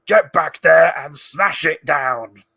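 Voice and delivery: loud, drawn-out orc command; orc bark. Acted. Orcs ordering elves to fell a tree, shouted orc voice. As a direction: order them about like a brutish orc.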